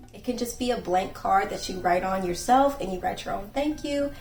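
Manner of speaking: ordinary talk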